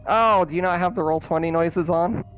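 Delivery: ordinary talk, nothing marked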